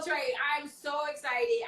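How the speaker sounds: ordinary talk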